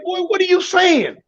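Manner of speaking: a hint of a confused mom on the phone; puzzled mama. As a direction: play his puzzled mother asking what he means.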